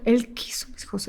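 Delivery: a soft hushed whisper. Retold or imitated. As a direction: breathe it out with no voice. Hushed answer to school director.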